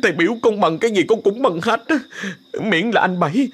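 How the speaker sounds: high, desperate begging; terrified pleading. Acted. Sáu Nổ begs master to spare him, high desperate. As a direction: plead for your life. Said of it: "đã sợ càng thêm sợ".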